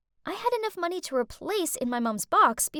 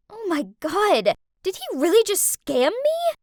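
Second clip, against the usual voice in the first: louder and high with disbelief; shocked disbelief; 6 semitones higher, 5 dB louder than usual. Acted. Girl realizing her boyfriend scammed her, shocked.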